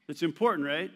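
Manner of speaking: normal talking voice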